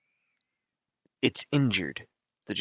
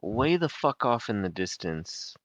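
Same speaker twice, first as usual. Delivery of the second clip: normal talking voice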